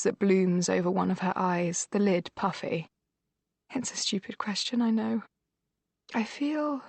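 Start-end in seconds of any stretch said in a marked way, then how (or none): 6.1-6.8 voice cracking. A narrator's reading. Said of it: "her voice cracks".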